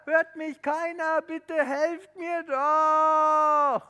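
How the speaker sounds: loud high shouting, stretched out; screaming for help. Acted. Tobi calling again from the ledge, shouting desperately. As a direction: scream for rescue. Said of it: "hat er wieder geschrien".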